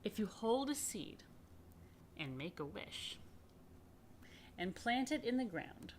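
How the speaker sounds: storybook reading, fading to hushed wonder; reading to children. Acted. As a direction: read it like a bedtime tale. Reading picture book to children.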